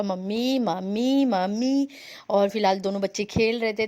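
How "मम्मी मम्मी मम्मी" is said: imitating toddler. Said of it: "कन्नू का".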